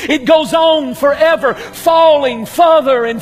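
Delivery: rising fervent preacher's cadence; preaching. Acted. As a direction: build it into a sermon climax. Preaching on the bottomless pit.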